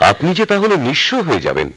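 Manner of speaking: high, alarmed protest; aghast. Acted. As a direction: cry out in disbelief. Servant aghast she'll be ruined.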